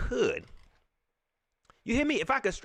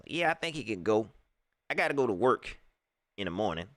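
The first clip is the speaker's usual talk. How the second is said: a lower put-on trainer voice; lightly voiced trainer; 9 semitones lower, faster than usual. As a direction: keep the mock Kevin voice going. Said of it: "Kevin don't even talk like that".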